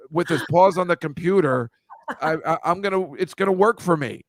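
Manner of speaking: usual speaking voice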